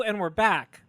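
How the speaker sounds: ordinary talk, nothing marked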